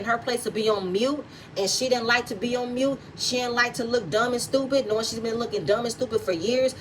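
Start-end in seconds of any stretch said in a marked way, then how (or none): none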